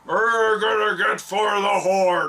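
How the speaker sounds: slow, shouted orc battle cry; gargling orc voice. Pretend. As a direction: roar it like a tusked orc. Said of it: "you're trying to talk like this".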